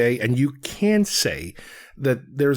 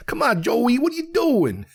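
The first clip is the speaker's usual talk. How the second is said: high, hammed-up New York movie dialogue; 11 semitones higher than usual. Critic parodying a movie New Yorker talking to Joey, mock New York accent. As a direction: parody a New York guy calling out a buddy. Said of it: "stereotypical New York accents".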